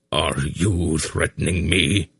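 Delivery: slow, disbelieving demand; can't believe his grandson would threaten him; incredulous. A narrator's reading. Old Belgarath demands of Geryon, slow and incredulous. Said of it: "Belgarath demanded incredulously".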